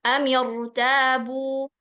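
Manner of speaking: amirtabu dibaca pelan, r tebal; tajwid demo. Shown as a demonstration. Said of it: "Jadi, bacanya". (amirtabu dibaca lambat dengan ro tebal)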